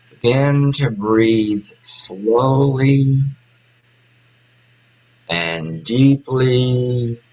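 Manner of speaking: very slow, spacious meditation guidance; guided meditation. Real, not acted. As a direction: guide them into slow breathing, stretching words between long pauses.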